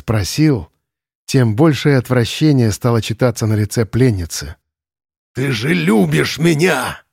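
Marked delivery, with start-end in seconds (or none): 5.3-7.0 slow and menacing; sinister. A narrator's reading. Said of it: "как-то зловеще произнёс". (зловеще)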